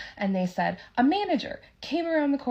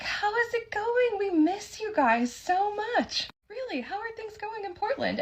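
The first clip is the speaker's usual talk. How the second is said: much higher, slow, doting chatter; gushing; 12 semitones higher than usual. Retold or imitated. Waitress fussing over the two travellers, high and gushing. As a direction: lay on the we-missed-you warmth.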